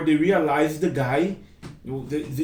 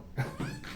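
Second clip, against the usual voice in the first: light laugh; 13 dB quieter than usual. Real, not acted. Laughs at own imitation.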